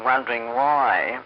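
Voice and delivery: usual speaking voice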